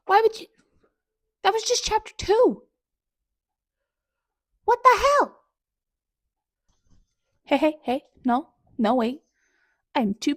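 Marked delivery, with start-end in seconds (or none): 0.1-5.4 much higher and louder, outraged; anguished outcry. Real, not acted. Reader yelling at the author after chapter two, anguished. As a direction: cry out at the author in betrayed disbelief.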